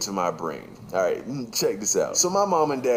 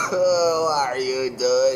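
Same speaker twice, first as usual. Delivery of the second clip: very high, wailing mock sob; hammed-up child crying; mock sobbing kid; 5 semitones higher, 7 dB louder, slower than usual. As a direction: whine it like a kid bawling.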